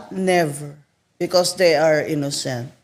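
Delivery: normal talking voice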